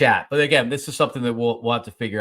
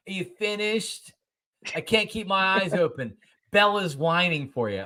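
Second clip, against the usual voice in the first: lightly voiced impatient wife, a bit higher; wife's text read aloud; 7 semitones higher than usual. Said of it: "my wife is text bombing me".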